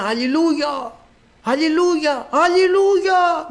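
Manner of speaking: soaring retold hallelujah call; imitated inner shout. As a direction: act out an insistent voice calling out, stretching the last one. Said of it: "κάποιος μέσα μου φώναζε".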